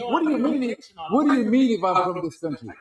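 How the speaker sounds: ordinary talk, nothing marked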